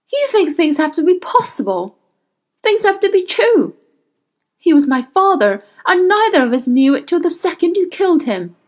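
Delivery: high, impassioned accusation; anguished outburst. A narrator's reading. Will to his father's killer, anguished outburst. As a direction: hurl it at them, high and pained. Grieving Will rages at the witch.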